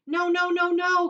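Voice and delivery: high, loud alarm; crying out. Acted. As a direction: shout to stop him. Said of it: "cried Mister Wonka".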